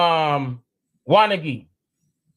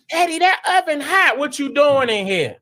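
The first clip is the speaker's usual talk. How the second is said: put-on parent scolding child; 8 semitones higher than usual. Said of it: "how I tell my children".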